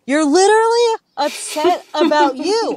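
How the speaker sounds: exasperated point. Real, not acted. Scolding husband.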